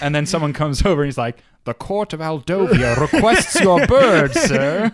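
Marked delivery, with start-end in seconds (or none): 1.6-4.9 high, loud mock herald; hammed-up formal summons, close to laughing; court messenger. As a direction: proclaim it like a royal messenger.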